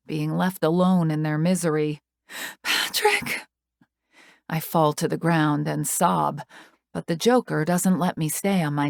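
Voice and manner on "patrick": high breathless cry of his name; anguished cry. A narrator's reading. Crying after Patrick as he is gone. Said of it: "My cries echo".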